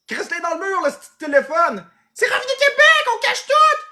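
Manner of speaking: very high-pitched shouting; acted frantic yelling. Household fed up with the ringing phone, high frantic yell.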